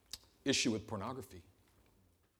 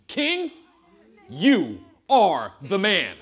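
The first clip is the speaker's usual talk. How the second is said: loud, slow, each word landed; prophet's booming slow indictment; slow accusation; 6 semitones higher, 15 dB louder, much slower than usual. Acted. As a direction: point the finger slowly. Nathan confronts David.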